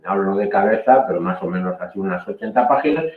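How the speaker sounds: ordinary talk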